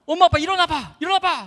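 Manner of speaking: high, urgent calling out; loud, high-pitched re-enacted yell; excited wake-up call. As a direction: rouse the sleeping household. Bursts in to wake parents.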